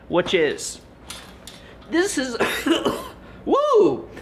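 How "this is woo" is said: high whoop. Real, not acted. Vlogger warms up before answering, whooping high. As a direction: whoop to reset himself.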